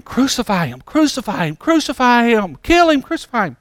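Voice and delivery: high, rising chant without full volume; restrained mock scream, pitched high; imitated crowd screaming. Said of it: "people screaming".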